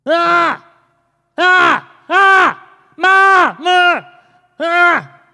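high, loud mock baby cries; repeated bursts of wailing for mama, hammed-up; imitated baby wail. Preacher imitating an ignored baby, loud high wailing. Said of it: "this is what the baby's face was".

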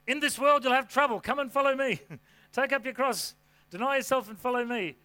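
high, punchy sales-pitch delivery; mock slogan pitch. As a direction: sell the hard news like an upbeat slogan. Jokes scripture as marketing slogan.

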